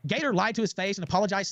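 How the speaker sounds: ordinary delivery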